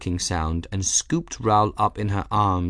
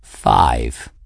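low, loud, self-satisfied cat; smug; 10 semitones lower, 5 dB louder than usual. A narrator's reading. Cat looking pleased with himself to Little Mouse, smug. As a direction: announce it proudly in a deep cat voice. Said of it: "he said smugly".

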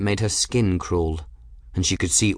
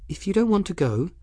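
put-on higher-pitched girl, reasoning firmly; earnest girl; 11 semitones higher, faster than usual. Bridget persuading frightened Folly to go on, earnest.

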